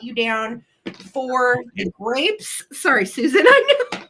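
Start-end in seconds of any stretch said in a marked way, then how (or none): 2.7-4.0 laughing. Real, not acted. Laughs at own mistake.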